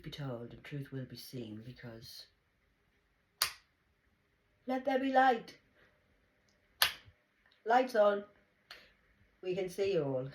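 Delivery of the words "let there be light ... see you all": booming decree, higher and louder; loud proclamation. Pretend. Proclaiming light to the unseen audience, booming. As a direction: call it out like a prophet lighting the room.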